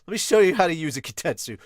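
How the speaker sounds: a hammed-up annoyed scold; mock angry Zoro; Zoro yelling.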